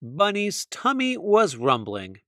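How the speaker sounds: plain reading voice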